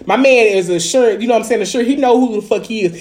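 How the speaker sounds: normal talking voice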